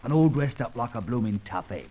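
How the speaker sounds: curious Cockney, teasing; London Cockney, amused by the disguise; Cockney accent. Acted. Old crook teasing his visitor, in Cockney.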